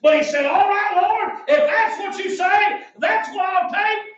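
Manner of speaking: high, insistent preaching; measured, ringing declarations of faith; full-force preaching. Acted. Preacher voicing the man taking Jesus's word, full-force preaching.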